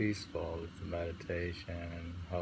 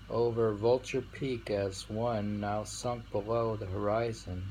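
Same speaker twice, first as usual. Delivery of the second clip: louder, slow solemn recital; poem reading; 3 semitones higher, 3 dB louder, slower than usual. Acted. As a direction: proclaim the verse gravely. Reads sutra poem aloud.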